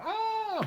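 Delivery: very high shriek while tumbling; falling shriek. A narrator's reading. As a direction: squeal as you land. Sister falls out of bed.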